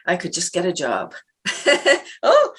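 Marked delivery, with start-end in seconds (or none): 1.4-2.0 breathy laugh. Real, not acted. Laughing at own joke.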